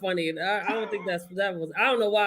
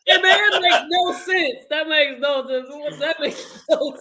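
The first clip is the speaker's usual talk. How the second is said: higher, louder protest, laughing by the end; 7 semitones higher, 5 dB louder than usual. Real, not acted. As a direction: argue it while cracking up. Laughing at nonsense saying.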